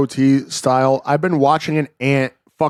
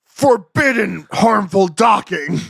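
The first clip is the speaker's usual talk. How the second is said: anime attack shout; 4 semitones higher than usual. Acted. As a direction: call out the special move.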